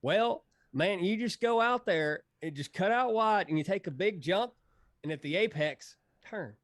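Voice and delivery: high, loud lazy Texas drawl; Spitz's Texas drawl. Retold or imitated. Imitating Spitz's Texas accent while retelling his coaching. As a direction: do your buddy's Texas accent, big and high. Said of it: "I'm gonna say this in my best Spitz accent".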